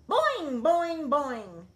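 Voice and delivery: high, loud springy boings; bouncy boing. A narrator's reading. Froggy bouncing in his seat.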